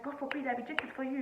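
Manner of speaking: imitating a high, outraged Nigerian mom; mock-scandalized mother rejecting it. Mimicking her mom rejecting an older husband, high and outraged. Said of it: "my mom was like".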